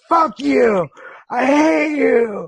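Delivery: high, loud, drawn-out mock wail; frustrated outburst. Tired patient lashing out at partner over another shower, frustrated wail. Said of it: "I was tired, I was frustrated".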